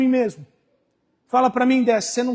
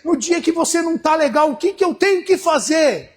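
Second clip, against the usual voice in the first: raised, louder preacher's call; rising sermon fervor; preaching; 5 semitones higher, 4 dB louder than usual. Acted. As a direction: lift the crowd with each "no dia". Preaching builds to the bad day.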